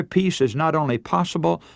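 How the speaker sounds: usual speaking voice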